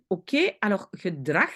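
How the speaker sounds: ordinary talk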